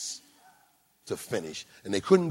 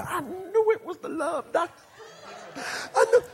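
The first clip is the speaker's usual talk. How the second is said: excited lady; 13 semitones higher than usual. Retold or imitated. Lady recognizes the Love Doctor.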